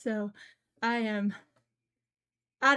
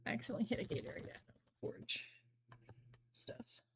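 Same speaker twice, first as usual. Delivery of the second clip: hushed whisper, faster; embarrassed streamer whispering about a misclicked ad; whispered aside; 9 dB quieter than usual. Real, not acted. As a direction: mutter it under your breath.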